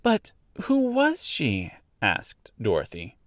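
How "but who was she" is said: put-on high, slow girl's voice; questioning young girl. A narrator's reading. Girl asks who the dead witch was, puzzled. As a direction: ask in a high, wondering voice.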